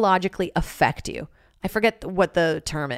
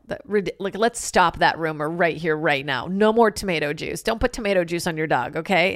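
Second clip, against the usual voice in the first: usual speaking voice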